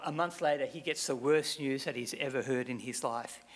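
usual speaking voice

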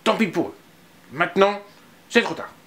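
put-on sulky child. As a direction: sulk with satisfaction. Anton sulks, playing dead.

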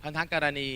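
"พันธกรณี" said softly, syllable by syllable; อ่านแยกพยางค์. Shown as a demonstration. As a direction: quietly count out pan-tha-ko-ra-nee in "พันธกรณี". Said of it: "อ่านกี่พยางค์". (อ่าน "พันธกรณี" เบาๆ ทีละพยางค์)

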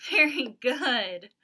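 loud, slow, beaming praise; excited praise. Real, not acted. Teacher cheering the class after the song, loud.

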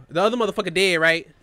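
usual speaking voice

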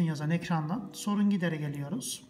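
ordinary talk, nothing marked

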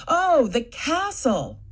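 "oh" falls as "castle" clicks into place; slow "castle", realised after sounding it out; figuring out a word. Shown as a demonstration. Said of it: "try sounding them out".

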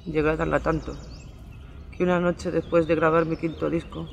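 ordinary talk

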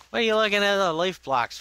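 high, loud outraged shout; angry yell. Acted. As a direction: yell at the nosy villager. Yelling at villager.

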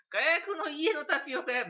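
火薬の家のタピオカ屋 said high, loud and slow through a laugh. Shown as a demonstration. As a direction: blurt 火薬の家のタピオカ屋 while cracking up. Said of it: "別に火薬の家のタ-、タピオカ屋ではないからね". (笑いながら高く大きくゆっくり「火薬の家のタピオカ屋」)

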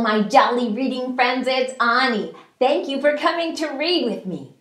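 usual speaking voice

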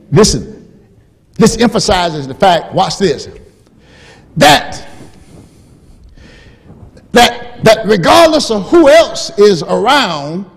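loud, high, rising sermon delivery; full-force preaching. Acted. Preacher driving home the word my to the church, loud. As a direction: build the point to the rafters.

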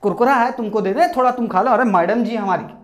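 ordinary talk

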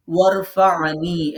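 ওয়ারফা'নি said slowly with a clear ain after ফা; ain in the throat. Shown as a demonstration. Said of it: "এটার মধ্যে একটা আইন আছে".

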